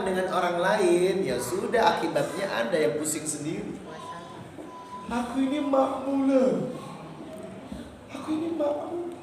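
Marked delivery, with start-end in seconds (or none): 5.1-9.1 imitated pleading parent. Mimics a parent pleading for recognition.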